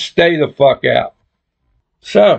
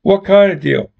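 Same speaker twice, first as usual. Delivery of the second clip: usual speaking voice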